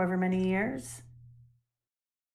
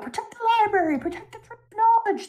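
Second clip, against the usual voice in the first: much higher, frantic call to save the library; panicked shout; 13 semitones higher, faster than usual. Retold or imitated. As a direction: shout it as if bombs are falling around you. Panicked scholars under bombardment.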